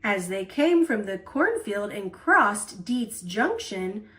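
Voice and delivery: plain reading voice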